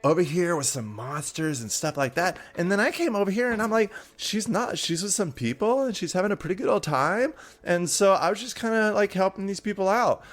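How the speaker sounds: high, perky, eager storytelling voice; excited half-sister, chattering. Acted. Half-sister explains to Ayla why she came, high and excited.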